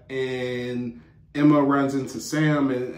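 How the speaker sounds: ordinary talk, nothing marked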